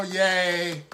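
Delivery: long, low, listless yay; mock a half-hearted cheer; unenthusiastic cheer. Preacher mimicking how mother never cheered, unenthusiastic. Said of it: "She didn't just sit there and go".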